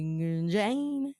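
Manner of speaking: "Gorgina" with the "jai" stretched out long; emphasis on JAI. Shown as a demonstration. Host showing another emphasis, long drawn-out JAI in "Gorgina". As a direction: slide slowly through a long "jai" in "Gorgina". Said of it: "wherever you wanna put the emphasis".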